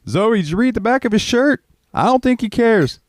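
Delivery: high mock voice delivering a dry jab; teasing coworker. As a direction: poke fun at the shirt in a put-on high voice. Coworker's deadpan quip quoted.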